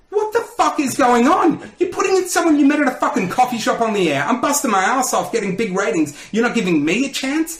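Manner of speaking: high-pitched outraged yelling at the boss; retold angry yell. Sleep-deprived presenter rants at the program director, angry yelling. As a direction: re-enact blowing up at your boss. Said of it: "I just yelled at him".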